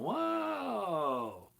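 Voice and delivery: mock whoa. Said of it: "And I'm going".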